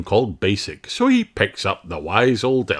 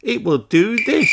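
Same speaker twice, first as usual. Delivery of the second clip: put-on elf boy voice; 4 dB louder than usual. A narrator's reading. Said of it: "says Ben".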